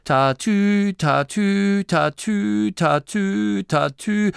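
high sung police siren; siren imitation. The narrator imitates the cars' singing siren. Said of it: "diese Melodien von der Autobahn".

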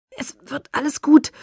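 high, strained gasped words; pained reassurance; forced out. Acted. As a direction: push the words out through pain. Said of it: "stieß Edward wieder hervor".

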